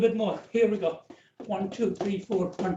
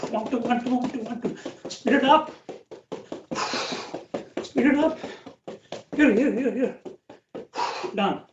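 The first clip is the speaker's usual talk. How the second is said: coach calling out; 3 semitones higher than usual. Real, not acted. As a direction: drive them through the last seconds. Coach urging sprint.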